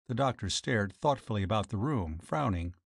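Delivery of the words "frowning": ordinary delivery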